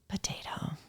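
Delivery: "Potato" in a low, breathless whisper of a rough day; whispered sad potato. Retold or imitated. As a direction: let "Potato" sink to a whisper.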